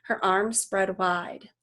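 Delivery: ordinary delivery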